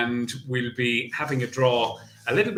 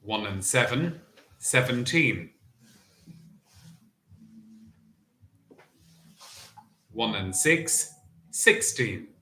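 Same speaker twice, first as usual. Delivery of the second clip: bingo caller. Acted. Bingo number calling.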